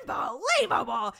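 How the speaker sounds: high, loud mock scream. Daughter storming off from father, mock screaming. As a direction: belt it out like an exasperated teen. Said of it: "she literally just screams".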